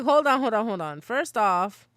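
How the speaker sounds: hammed-up defensive interruption, pitched high; replaying a frantic stop-right-there; retold loud protest. Retelling her own defensive reply.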